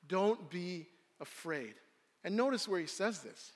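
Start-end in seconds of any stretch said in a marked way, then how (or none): 0.1-1.7 slow, softer, tender; calm them slowly and softly; gentle reassurance. Acted. Jesus to his frightened disciples, gently reassuring.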